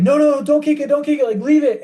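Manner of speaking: high frantic yell at a stranger; panicked runner yelling don't kick it. Retold or imitated. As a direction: shout him off the bottle. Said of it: "And I yell".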